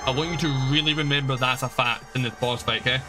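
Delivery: normal talking voice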